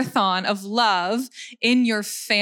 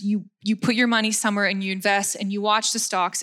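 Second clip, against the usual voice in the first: normal talking voice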